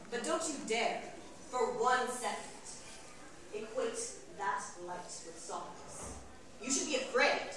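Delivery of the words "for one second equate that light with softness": ordinary talk, nothing marked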